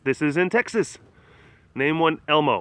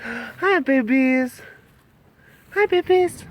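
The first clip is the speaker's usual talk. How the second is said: high little greeting to babies; 9 semitones higher, slower than usual. Real, not acted. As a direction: coo it high, as if to tiny animals. Cooing to baby animals.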